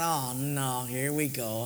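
low, soft, defeated mutter; resigned groan. Retold or imitated. Preacher dreading his running partner's arrival, soft resigned groan. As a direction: sink into dread as he pulls up.

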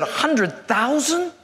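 slow, rising disbelief; astonished. Acted. New hire hears the salary, astonished. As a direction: stretch it into a stunned question. Job seeker stunned by the salary.

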